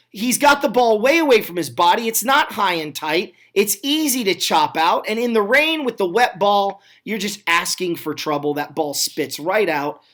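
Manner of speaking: ordinary talk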